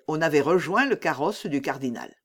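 plain reading voice

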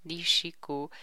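usual speaking voice

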